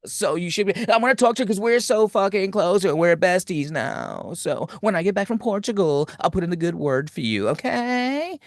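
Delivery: high, excited gushing friend voice; gushing valley girl. Retold or imitated. Successful friend bragging about her Portugal trip, gushing high. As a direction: gush like a bubbly valley girl. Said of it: "She's like".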